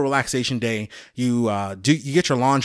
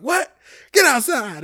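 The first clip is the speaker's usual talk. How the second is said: much higher, louder startled bark; a drill sergeant shocked by a recruit's insult; hammed-up sergeant outrage; mock outraged sergeant; 12 semitones higher, 3 dB louder, slower than usual.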